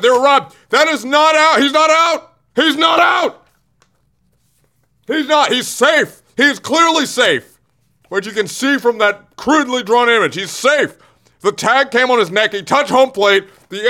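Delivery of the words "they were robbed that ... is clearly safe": high, loud outraged yelling; furious rant. Real, not acted. Host raging at the umpire's call on screen, shouting. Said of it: "I'm just so angry".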